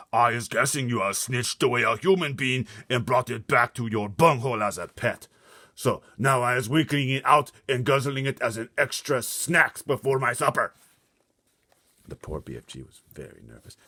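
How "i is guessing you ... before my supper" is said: loud high booming giant. Acted. Blood Bottler booming at the BFG. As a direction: boom the insult.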